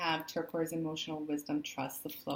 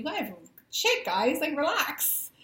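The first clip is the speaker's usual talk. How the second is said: laughing; 8 semitones higher, 4 dB louder, slower than usual. Real, not acted. Laughing at panicking viewers.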